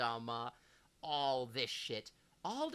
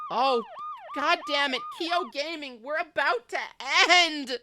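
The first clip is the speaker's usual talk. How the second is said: excited shout; 11 semitones higher, 12 dB louder than usual. Real, not acted. Streamer startled by incoming raid.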